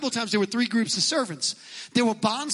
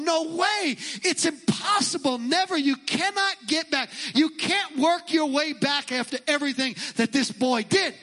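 higher, indignant protest in the voice of the Pharisees; mocking Pharisees; 5 semitones higher than usual. Preacher voicing scandalized Pharisees to the congregation, higher and indignant. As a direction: play the outraged Pharisees, high and insistent. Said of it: "the Pharisees are listening to this and they're going".

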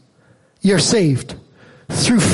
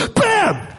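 mock bam; 12 semitones higher than usual. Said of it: "the mother just turns around and goes".

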